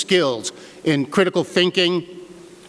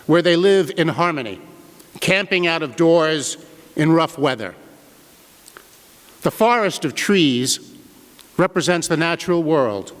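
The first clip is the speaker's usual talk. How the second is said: ordinary delivery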